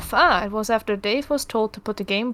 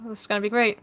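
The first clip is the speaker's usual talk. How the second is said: normal talking voice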